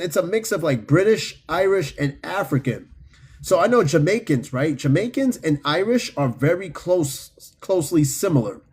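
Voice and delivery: ordinary delivery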